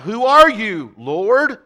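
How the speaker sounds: loud, high anguished cry. Acted. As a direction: cry it out in shock to the voice. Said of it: "And he cries out".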